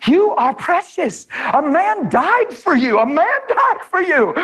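rising, slow, fervent; affirming preaching. Retold or imitated. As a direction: build it up to the mirror. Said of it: "look in the mirror and say".

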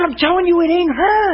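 very high-pitched imitation of alarm; mock frantic insistence. Preacher imitating frightened caller, mock frantic insistence.

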